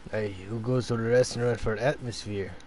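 low gruff sea captain voice; tugboat captain. Acted. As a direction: talk like an old salty captain. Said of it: "Why do you sound like the sea captain?".

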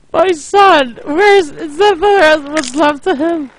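hammed-up anguished shouting; mock anguished cry. Real, not acted. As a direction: cry out for the lost son with mock grief. Son blown up in game.

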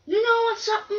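very high-pitched teasing voice; taunting trucks. Acted. Trucks start teasing Gordon, taunting. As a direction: squeak it out high.

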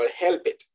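help it in two syllables for helped; wrong -ed pronunciation. Shown as a demonstration. Teacher warning students, helped mispronounced as help it. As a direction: add a vowel so helped becomes help it. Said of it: "no me vayan a decir".

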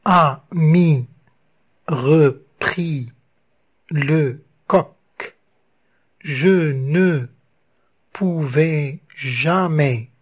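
slow syllable by syllable scansion; counting syllables. Shown as a demonstration. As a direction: split into syllables with pauses between. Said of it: "Le découpage".

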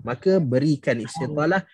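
normal talking voice

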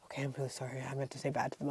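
sheepish apology. Retold or imitated. Classmate sheepishly apologising for swearing, low and soft. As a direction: mumble it like a scolded boy.

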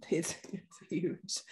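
slow, soft, congested delivery; stuffy-nosed. Real, not acted. Co-host playing on her head cold, stuffy-nosed. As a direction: say "huge" through a blocked nose. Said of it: "'cause I'm really stuffy".

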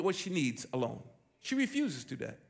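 ordinary talk

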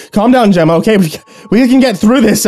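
desperately trying to calm her; husband begging his wife to calm down; panicked pleading. Acted.

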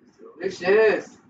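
normal talking voice

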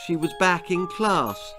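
ordinary delivery, nothing marked

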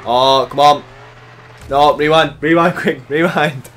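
loud, high urgent calls; exasperated shouting. Real, not acted. Gamer after a mishap, shouting urgently to rewind. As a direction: shout for a quick do-over.

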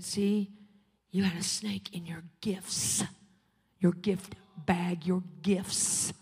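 slow, low whispered voice of God; God's voice. Retold or imitated. God warning the preacher in a dream, slow whisper. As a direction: speak softly and gravely, like God confiding. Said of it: "And God said this to me".

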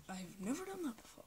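very soft replay of the line; quiet. Shown as a demonstration. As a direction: say it barely audible. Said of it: "If you could not hear me".

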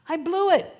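rueful loud admission. Real, not acted. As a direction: blurt it out, laughing at yourself. Laughing confession of failure.

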